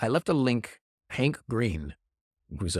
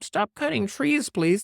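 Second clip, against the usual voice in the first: mock pleading; 11 semitones higher than usual. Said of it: "You're gonna be like".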